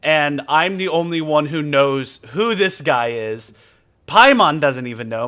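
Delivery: usual speaking voice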